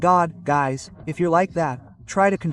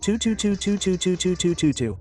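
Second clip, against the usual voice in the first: rapid repeated "tu-tu-tu" rotor sound; imitated helicopter noise; 2 semitones higher than usual. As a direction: mimic helicopter blades with the mouth.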